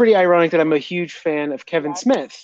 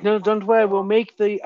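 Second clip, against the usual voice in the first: put-on German accent; 3 semitones higher than usual. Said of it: "I'm saying it in a German accent".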